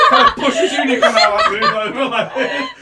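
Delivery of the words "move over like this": usual speaking voice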